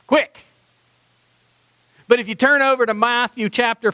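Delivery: a quick, high, loud snap of a word; mock hurried Mark. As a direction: toss it off like an impatient narrator. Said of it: "he's like".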